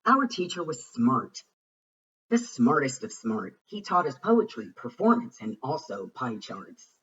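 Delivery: usual speaking voice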